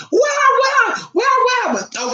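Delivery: high shrieking mock panic; shocked mother. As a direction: squeal it like a startled mom.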